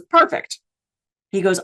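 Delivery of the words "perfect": high, chipper "Perfect"; cheerful cast member; bright perfect. Retold or imitated. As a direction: pop up high with delight. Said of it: "And he goes".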